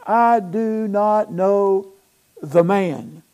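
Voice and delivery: slow, deliberate denial; emphatic, measured acted denial; sworn denial. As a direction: space out every word as if under oath. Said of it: "he denied it with an oath".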